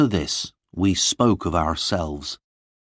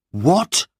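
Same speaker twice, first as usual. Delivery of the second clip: high, louder astonished "What?"; shocked cry; 10 semitones higher, 5 dB louder than usual. Acted. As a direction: cry out in disbelief. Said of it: "cried Lorna".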